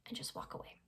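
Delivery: breathy whispered aside; hushed whisper. Real, not acted. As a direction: drop to a whisper to finish the advice. Quiet aside advice.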